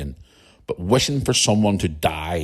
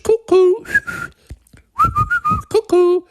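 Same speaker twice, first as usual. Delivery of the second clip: sing-song high cuckoo; cuckoo call; slower than usual. Pretend. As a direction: chirp it out like the bird in a cuckoo clock. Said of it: "I mean, cuckoo clocks".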